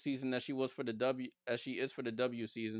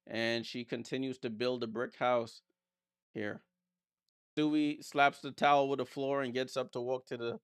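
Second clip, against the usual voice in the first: ordinary talk, nothing marked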